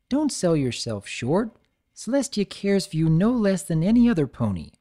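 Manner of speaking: kind friend reassuring; lightly voiced unicorn; Twilight voice. Twilight encourages.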